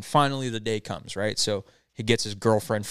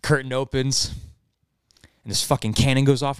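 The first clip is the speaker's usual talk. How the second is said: ordinary talk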